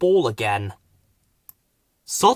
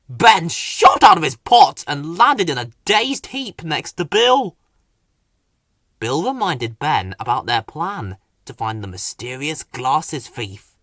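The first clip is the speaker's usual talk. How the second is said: usual speaking voice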